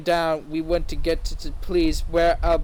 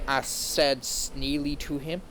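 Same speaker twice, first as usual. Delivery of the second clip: plain reading voice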